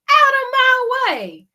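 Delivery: high, loud shout; goose shouting. Acted. Goose shoving past the others. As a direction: barge through.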